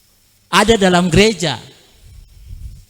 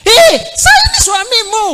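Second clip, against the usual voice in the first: higher, louder, exasperated cry; frustrated husband shout; 4 dB louder than usual. Retold or imitated. Husband shouting to his wife that he is her husband, exasperated. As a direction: yell it in desperation to be recognised.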